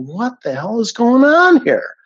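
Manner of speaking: high, loud disbelief; a sharp, loud cry of disbelief at the news; incredulous outburst. Retold or imitated. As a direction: blurt it out, stunned and angry. Retelling his shocked reply.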